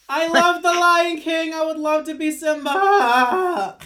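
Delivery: loud, high, drawn-out fan outburst; gushing excitement. Real, not acted. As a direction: shout your love, stretching the words out. Gushing over dream role.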